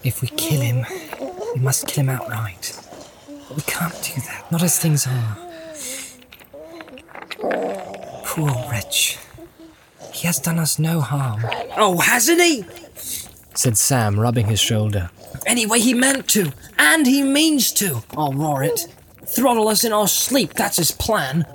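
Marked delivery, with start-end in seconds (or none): 0.0-11.4 soft, thoughtful, a little higher; pitying Frodo. Acted. Frodo arguing against killing the poor wretch, soft pitying voice. 11.8-12.7 high-pitched scornful retort; indignant Sam. Acted. Sam contradicting his master, high indignant retort. 15.4-21.4 high, emphatic servant voice; indignant Sam. Acted. Sam warning his master of Gollum's plan, high indignant voice.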